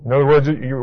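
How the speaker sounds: ordinary delivery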